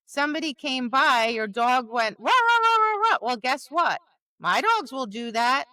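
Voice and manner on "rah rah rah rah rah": loud high barking yaps, dog imitation; high, louder mock dog bark; imitated dog barking.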